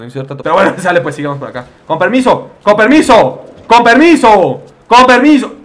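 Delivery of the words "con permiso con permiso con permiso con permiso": from plain to high and loud; escalating polite shout; building excuse-me. Real, not acted. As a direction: push through the crowd, rising. Repeating, building up.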